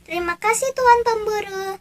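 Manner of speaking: excited. Acted.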